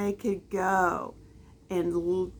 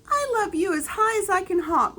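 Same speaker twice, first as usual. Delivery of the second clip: high, fast giggly little hare; bouncing bunny full of glee; laughing little hare; 11 semitones higher, 4 dB louder, faster than usual. A narrator's reading. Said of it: "laughed Little Nut Brown Hare".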